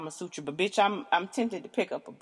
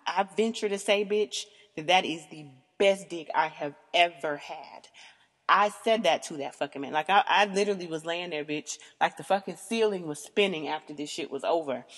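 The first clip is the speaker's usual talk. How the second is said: ordinary talk, nothing marked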